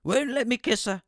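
high, bright fatherly phone voice; doting dad answering the phone; cheery dad. Retold or imitated. Dad voiced, mock complaint.

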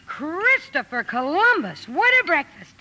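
high-pitched, thrilled exclamations; amazed delight. Acted. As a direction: marvel at a wonderful feast. Amazed at Christmas breakfast.

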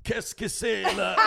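high, slow, laughing French-Canadian mock voice; mock Québécois French. Mocking a confused Quebec ATM customer, in a hammed-up Québécois accent. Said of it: "and they're like".

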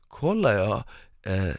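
ordinary delivery

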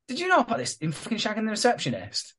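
high-pitched imitation of nosy questioning; put-on high voice, asking around; mock indignant questioning. Acting Susan quizzing the whole street.

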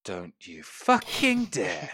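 threat. Acted. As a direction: spell out each word as a threat. Dolores warns the captain.

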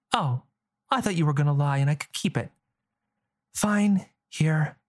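put-on girl sulking, then giving in; young girl voice. Girl character speaking.

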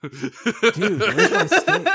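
high, loud laughing surfer-dude voice; Keanu Reeves impression. Mocking the Dracula casting, laughing surfer-dude voice. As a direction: goof on a stoner dude mid-laugh. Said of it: "perfect impersonation of Keanu Reeves in Dracula".

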